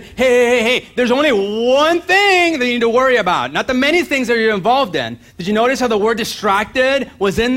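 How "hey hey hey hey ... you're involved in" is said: higher, louder call of Jesus; urgent Jesus calling someone back; Jesus calling Martha back, higher and louder. Retold or imitated. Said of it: "how Jesus said".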